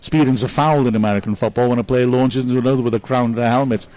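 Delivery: normal talking voice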